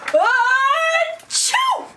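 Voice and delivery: high, loud acted achoo; big mock sneeze. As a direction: explode into a storybook sneeze. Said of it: "He blows and he sneezes".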